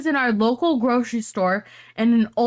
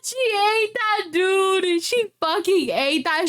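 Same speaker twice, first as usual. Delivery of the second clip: much higher, slow savouring delight; gleeful praise; 8 semitones higher, slower than usual. Real, not acted. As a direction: relish every word of praise. Praising the comeback excitedly.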